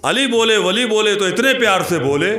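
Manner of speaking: high, projected declamation; Josheela शेर-पाठ. Acted. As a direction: launch the verse high, easing down at the end. शेर जोश से दोहराना. (ऊँचे सुर में जोश से शेर पढ़ना)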